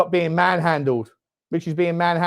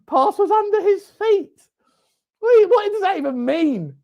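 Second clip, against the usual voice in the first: mocking the pundit; 11 semitones higher than usual. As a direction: parrot the pundit's line in a silly high voice. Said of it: "Never heard such bollocks in my life".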